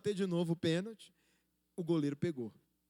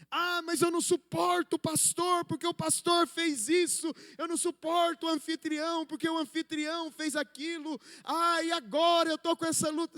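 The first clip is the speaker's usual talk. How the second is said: higher, louder mock complaint; complaining church member; 8 semitones higher, 3 dB louder than usual. Pastor mimics a churchgoer grumbling about the pastor, higher and louder. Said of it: "chegar na pessoa e falar assim".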